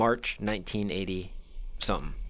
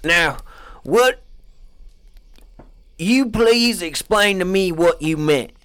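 high, loud mock interrogator; loud interviewer act; hammed-up interviewer; 6 semitones higher, 7 dB louder than usual. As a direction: press him hard. Interviewer role.

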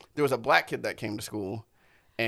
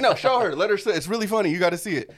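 higher, louder eager urging; mock eager kids; 7 semitones higher, 6 dB louder than usual. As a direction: urge him like excited classmates. Retold kids urging him.

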